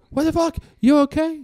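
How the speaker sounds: reliving the panic, yelling high; alarmed shout. Retold or imitated. Father yells at his daughter over speakerphone, alarmed. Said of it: "I'm like".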